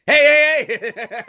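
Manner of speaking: high, loud holler, easing off after the first word; screaming to wake someone. Shown as a demonstration. Waking up somebody that's unconscious, screamed. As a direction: demonstrate a rousing shout. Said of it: "You gotta really scream at 'em".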